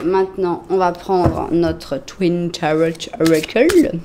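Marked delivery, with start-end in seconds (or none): 2.0-3.9 slow, deliberate bad English accent on the name; French speaker's awkward English accent; terrible English accent. Shown as a demonstration. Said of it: "mon terrible accent anglais".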